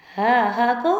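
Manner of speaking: ordinary talk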